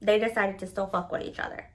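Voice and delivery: ordinary delivery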